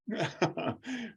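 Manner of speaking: loud high burst of laughter; real laugh. Real, not acted. Laughing at own story.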